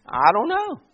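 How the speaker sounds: high, goofy cartoon dog voice; Scooby-Doo voice. Pastor jokes to the church in a cartoon dog voice. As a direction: play the cartoon dog shrugging it off. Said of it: "in the voice of Scooby-Doo".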